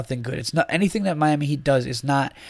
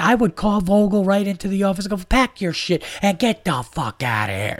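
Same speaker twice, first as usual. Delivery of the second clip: higher, fired-up impression of a buddy ranting about the coach; imitating a worked-up friend; 7 semitones higher than usual. Friend rants that he'd fire the coach, high mock fury. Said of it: "he's saying it just like that".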